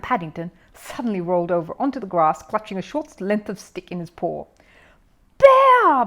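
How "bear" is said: huge, much higher furious bellow. A narrator's reading. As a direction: bellow the name in outrage. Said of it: "bellowed Mister Curry".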